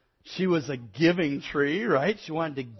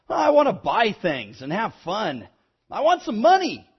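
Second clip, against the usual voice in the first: put-on demanding boy; 7 semitones higher, 8 dB louder than usual. A narrator's reading. Boy tells the tree what he wants, demanding. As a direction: lively voice climbing to a loud, high demand.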